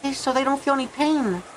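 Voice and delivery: high-pitched character voice, pleading; unhappy servant. Acted. Voices the servant's inner plea.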